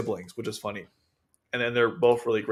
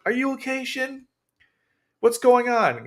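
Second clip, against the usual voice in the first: much higher pitched, put-on worry; mock fretting girlfriend; 12 semitones higher than usual. Jean to her man Shin, fretting. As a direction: imitate a doting woman fussing in a high voice.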